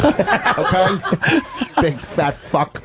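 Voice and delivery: insulting club-comic impression; high "okay?", then a slow, drawn-out insult; Rich Voss. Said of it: "All right, we'll do Voss first".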